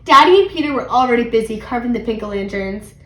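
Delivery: plain reading voice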